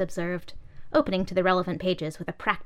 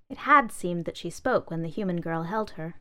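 usual speaking voice

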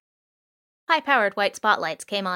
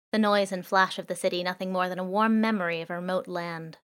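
ordinary delivery, nothing marked

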